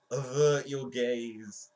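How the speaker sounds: loud, slow, drawn-out proclamation; mock-dramatic command. Streamer as he climbs the staircase, mock-dramatic cry. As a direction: proclaim it high and slow, hammed up.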